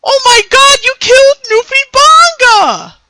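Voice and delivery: high-pitched cartoonish mock horror; exaggerated high squeal of outrage; mock outcry. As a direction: cry it out loud in a falsetto. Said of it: "I always am the one that say".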